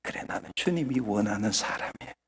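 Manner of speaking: very soft, low inward murmur; 속으로 말하듯. Shown as a demonstration. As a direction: say it privately to yourself, hushed. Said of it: "속으로 하라 그러니까". (속으로 되뇌듯 아주 작고 낮게)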